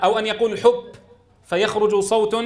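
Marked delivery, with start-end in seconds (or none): 0.6-0.8 حب ending in an unvoiced "p". Shown as a demonstration. Teacher shows the mistake, ب like P in حب. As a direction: drop the voice from ب in حب, making "hup". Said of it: "شبيه بالبي".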